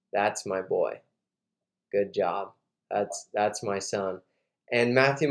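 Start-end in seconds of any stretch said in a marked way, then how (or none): 0.1-4.2 low, soft, halting praise; understated retelling of a moved father; quietly proud, lightly acted; tearful pride. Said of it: "he's crying".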